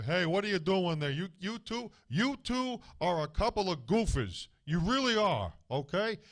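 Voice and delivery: put-on a dad ribbing the kids, loud and high; father's voice. Father scolding his kids, a loud high teasing call. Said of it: "or my father".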